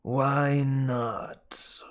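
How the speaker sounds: whispered, raspy snake retort; whispered snake demand. Acted. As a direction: hiss the challenge under the breath. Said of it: "George demanded".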